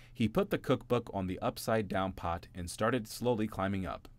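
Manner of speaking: ordinary delivery, nothing marked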